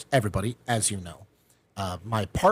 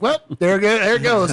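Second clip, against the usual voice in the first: louder, higher comic giving up; mock resignation; 9 semitones higher, 7 dB louder than usual. Man watching his first date collapse, mock loud resignation. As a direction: throw it away loudly as a lost cause. I'm thinking in my head.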